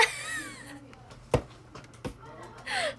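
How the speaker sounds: squealing embarrassed laugh; very high burst of laughter. Real, not acted. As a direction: shriek with giggles. Laughing at own line.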